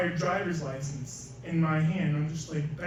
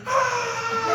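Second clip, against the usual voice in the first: loud, very high-pitched mock scream; imitated scream; 6 dB louder than usual. Friend heard over the phone when the lock opens, high scream. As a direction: let out a high shriek as the retold reaction.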